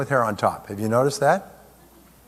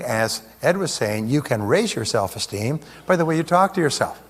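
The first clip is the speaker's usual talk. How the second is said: usual speaking voice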